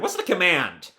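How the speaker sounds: normal talking voice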